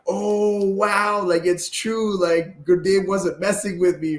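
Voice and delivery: high-pitched, wide-eyed wonder; amazed realization. Retold or imitated. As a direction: relive the amazement, voice lifted high. Acted amazed realization, voice raised.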